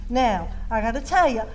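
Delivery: ordinary delivery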